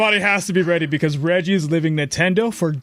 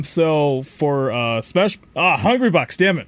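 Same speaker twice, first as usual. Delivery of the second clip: ordinary delivery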